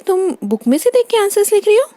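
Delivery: high, rising disbelieving question; incredulous mother, pitch climbing at the end; startled accusation. Acted. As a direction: catch the child red-handed. Mother catches daughter cheating.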